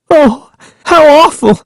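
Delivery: very high, horrified voice; Sari's trembling cry. A narrator's reading. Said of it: "her voice trembling".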